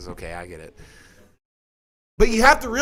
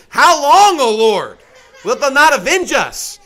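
higher, louder plea that fades; pleading cry of martyrs; 6 semitones higher, 5 dB louder than usual. Retold or imitated. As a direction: cry out to the Lord for justice. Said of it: "They're saying".

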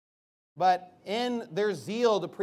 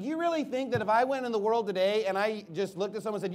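ordinary delivery

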